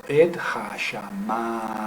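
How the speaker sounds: slow "Et hashamayim" with a stretched "ma"; stress on MA. Shown as a demonstration. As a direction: draw out the "ma" in "Et hashamayim". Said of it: "la, el acento".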